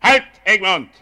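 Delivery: loud sharp halt; commanding call. Acted. Envoy halting Egmont at the door, loud command. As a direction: stop him in his tracks.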